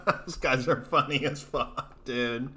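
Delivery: slow, soft words through laughter; laughing. Real, not acted. Laughing about comedy duo.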